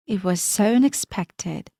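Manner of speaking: ordinary delivery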